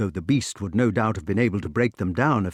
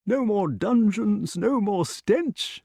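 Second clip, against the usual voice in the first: high, slow, savouring list; high drawn-out relief; rejoicing inventor; 9 semitones higher, slower than usual. A narrator's reading. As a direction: savour each freedom in a high voice.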